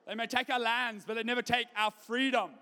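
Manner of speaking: put-on rally the troops in a poor Scottish accent; bad Scottish accent. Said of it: "that was not Scottish in the world".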